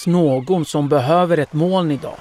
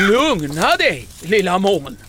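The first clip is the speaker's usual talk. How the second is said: high, anxious call; worried calling; 5 semitones higher than usual. A narrator's reading. Pine tree begs the thundering cloud to calm down, calling worriedly. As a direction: call out in worry, pitched up. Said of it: "ropar en orolig tall".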